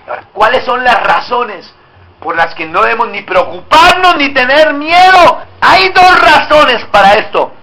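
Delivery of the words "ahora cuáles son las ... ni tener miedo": normal talking voice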